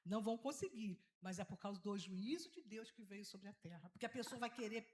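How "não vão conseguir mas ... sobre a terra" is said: usual speaking voice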